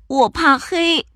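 high small cub voice; timid little bear. Acted. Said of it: "小小熊说".

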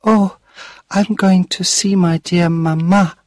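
put-on high, bright child's voice; a young girl's excitement; eager little girl. A narrator's reading. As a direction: speak as a little girl longing for her mother. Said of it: "Allegra said".

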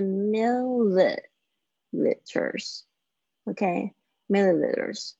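"milliliters" drawn out slowly, each syllable clear; teacher's slow model of "milliliters". Shown as a demonstration. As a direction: spell out "milliliters" slowly for learners. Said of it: "这个也有一点难念哈".

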